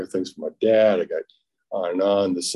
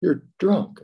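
higher, hurt voice of his wife; wife's accusation; 11 semitones higher than usual. Retold or imitated. Wife catching her drunk husband, a mock higher voice. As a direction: quote her like a stunned wife. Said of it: "she looks at me and says".

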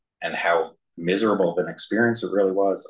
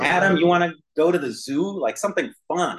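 higher, coaxing grown-up voice; coaxing parent; 10 semitones higher than usual. Retold or imitated. Parent coaxing little Adam toward the zoo, higher coaxing voice. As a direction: coax a sulky kid toward fun. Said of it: "It's like".

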